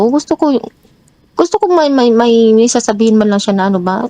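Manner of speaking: high-pitched, indignant retelling of her own complaint; replaying how upset she got, voice up high; retold protest. Retold quarrel with her partner. (mataas na boses, naiinis na pagkukuwento)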